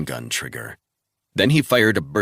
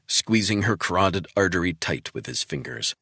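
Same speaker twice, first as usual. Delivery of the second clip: ordinary talk, nothing marked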